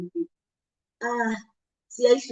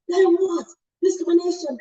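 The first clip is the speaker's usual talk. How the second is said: indignant outburst; 9 semitones higher, 4 dB louder than usual. Retold or imitated. Mocking the proprietor's reaction.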